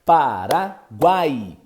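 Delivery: Paraguai stretched very slowly, the guai held; slow demo word. Shown as a demonstration. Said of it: "uma semivogal". ("Paraguai" bem devagar, destacando o ditongo)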